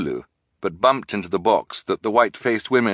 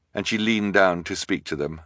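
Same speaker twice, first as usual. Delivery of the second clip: plain reading voice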